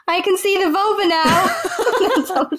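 giggling through the quote; laughing while quoting, slowed down. Real, not acted. Funny retold quote, laughing.

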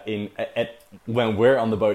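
ordinary talk, nothing marked

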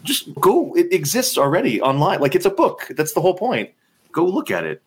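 annoyed author yelling at the award forms, high and exasperated; exasperated outburst. Retold or imitated. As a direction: throw up your hands, it's right there online. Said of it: "And I'm like".

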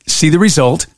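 ordinary delivery

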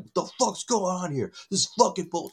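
mock yell, pitched high without the volume; high, half-volume imitation of an irate complaint; retold screaming. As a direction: imitate a man yelling at the front desk. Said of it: "screaming at him".

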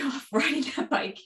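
usual speaking voice

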